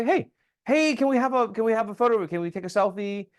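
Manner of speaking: high-pitched, excited call for attention; eager request. Acted. Witness asking committee members for a selfie, eager and high-pitched. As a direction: pipe up eagerly, voice pitched well up.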